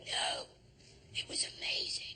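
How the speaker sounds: hushed whisper. Retold or imitated. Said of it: "looked at me and went".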